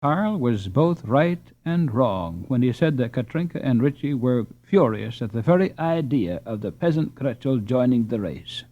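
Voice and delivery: plain reading voice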